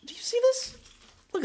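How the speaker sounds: high pitched, eager to show; excited. Real, not acted. Showing tiny wallet.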